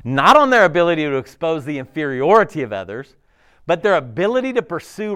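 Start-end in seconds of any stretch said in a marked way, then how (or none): 0.0-2.6 forceful preaching. Real, not acted.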